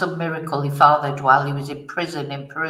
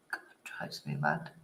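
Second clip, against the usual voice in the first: muttered under the breath, much softer, annoyed; frustrated whisper; 14 semitones lower, 12 dB quieter than usual. Real, not acted. To herself as the clip won't play, exasperated whisper. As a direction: sigh it out to yourself, irritated. Video blocked while sharing screen.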